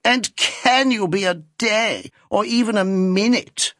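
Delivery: put-on an old lady's coy, teasing reproach; coquettish old lady. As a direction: flutter it out like an aging coquette. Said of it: "returned the lady".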